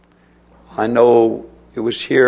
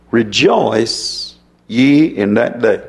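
drawn-out solemn reading of the verse; slow scripture reading; 3 dB louder, much slower than usual. Acted. Preacher reading the verse to his congregation, slow and solemn. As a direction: stretch each word like a proclamation.

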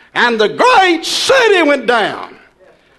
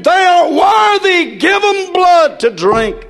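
heavenly voice crying out; 8 semitones higher than usual. Acted. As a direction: call it down from heaven. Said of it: "a voice cried from heaven".

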